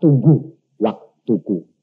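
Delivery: slow, low, measured answer; lightly voiced slow divine reply; God's voice. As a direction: speak it calmly like a patient god. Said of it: "Saya bilang".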